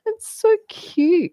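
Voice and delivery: high, slow, gushing coo. Retold or imitated. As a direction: coo it like something adorable. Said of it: "And I'm like".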